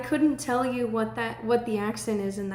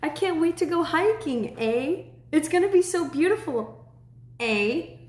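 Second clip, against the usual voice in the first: mock Canadian accent; 5 semitones higher than usual. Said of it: "my friends and I are just like".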